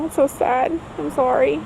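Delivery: ordinary talk, nothing marked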